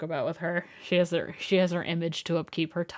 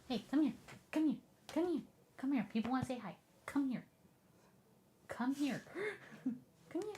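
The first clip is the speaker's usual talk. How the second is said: soft, raised pet-calling voice; coaxing the dog; 6 semitones higher, 10 dB quieter than usual. Real, not acted. Streamer calling her dog for the viewers, coaxing softly.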